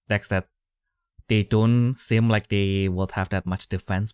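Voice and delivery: normal talking voice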